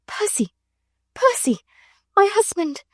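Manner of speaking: high, breathless cry of joy; joyful gasping call; gasped joy. A narrator's reading. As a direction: gasp his name, overcome. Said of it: "she gasped".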